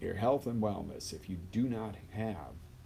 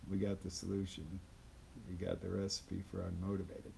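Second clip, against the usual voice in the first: deflated; 6 dB quieter than usual. Shown as a demonstration. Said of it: "Make yourself unmotivated".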